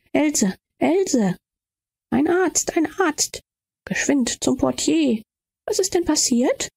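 high, anxious cries for help; alarmed calling. Acted. Aunt calls for a doctor.

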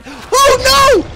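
very high, very loud scream; alarmed scream. Real, not acted. Player's kick goes wrong, alarmed scream. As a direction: shriek in sudden dismay.

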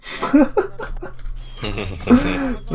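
high burst of laughter. Real, not acted. Laughing at the chaos in-game.

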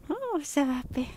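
high, bright sing-song for the dog. Real, not acted. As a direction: coo at the happy dog. Baby talk to dog.

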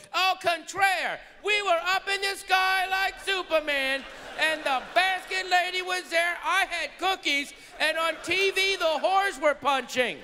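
very high, gushing voice in an altered timbre; enchanted passenger. Acted. Enchanted flier boasting to friends on the ground, high and gushing.

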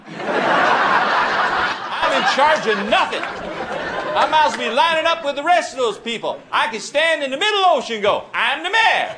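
high, slowed, hammed-up exasperation; mayor freaking out. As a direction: rant as the fed-up mayor, mocking him. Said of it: "he was freaking out".

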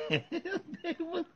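words squeezed out through high laughter; can barely talk for laughing. Real, not acted. Vlogger laughing too hard to finish, laughing through words.

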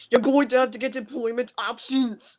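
imitation Austrian strongman; Arnold Schwarzenegger. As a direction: quote it in a mock action-hero accent. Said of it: "where he has the same voice".

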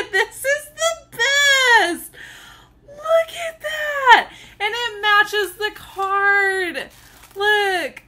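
high, loud, drawn-out squeals; overjoyed squealing. Real, not acted. Unveiling the tumbler from her Secret Santa, overjoyed squealing. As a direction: squeal slowly and loudly, close to happy tears.

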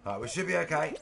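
usual speaking voice

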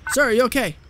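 loud urgent call to a downed man; man down shout. Real, not acted. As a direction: shout to check he's conscious. Calling to a collapsed man.

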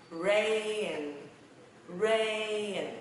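RAIN stretched slowly with the final N held; slow teaching demo of RAIN; slow, drawn-out reading. Shown as a demonstration. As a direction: sound out "rain" long and slow. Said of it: "Đọc nhanh ha".

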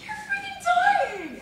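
high, slow laughing wail; laughing cry. Retold or imitated. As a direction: laugh through the pain. Laughing while running in pain.

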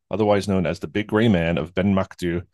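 ordinary talk

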